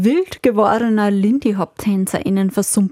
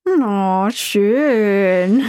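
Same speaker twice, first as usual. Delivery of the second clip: usual speaking voice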